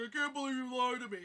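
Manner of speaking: imitated wounded rom-com hero; mock indignant boyfriend; mock hurt guy. Acting the film guy's hurt.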